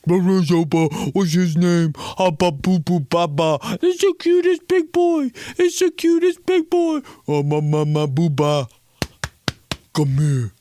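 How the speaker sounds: put-on high, loud gushing baby talk; baby talk to dog. Office worker cooing at a coworker's dog, high baby talk. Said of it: "Everybody just".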